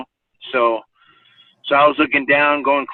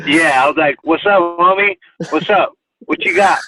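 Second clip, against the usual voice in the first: loud, high swaggering challenge; cocky taunt; 6 semitones higher, 5 dB louder than usual. Retold or imitated. Teen daring the little wrestler in the hallway, loud cocky taunt. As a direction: taunt him, chest puffed.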